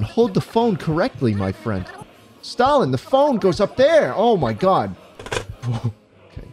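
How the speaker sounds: ordinary talk, nothing marked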